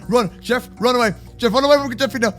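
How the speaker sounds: ordinary delivery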